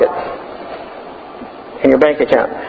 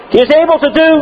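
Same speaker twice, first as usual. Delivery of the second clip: high ringing shout of the preacher; Scottish preacher shouting from the pulpit; 10 semitones higher than usual. Retold or imitated. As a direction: proclaim it to the rafters. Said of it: "then he would say".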